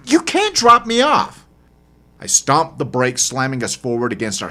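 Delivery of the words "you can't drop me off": high, insistent woman's voice, louder; lightly voiced upset woman. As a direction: refuse to be left behind.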